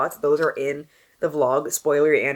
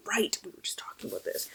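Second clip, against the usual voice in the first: whispered; 11 dB quieter, faster than usual. Retold or imitated. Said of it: "I was like".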